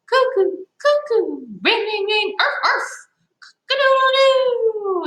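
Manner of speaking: squeaky high toy cuckoo, ringing, barking and crowing; toy noises. Pretend. Teacher imitating the little sister's toys for the kids, squeaky high. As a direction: play every chattering toy in a high squeak.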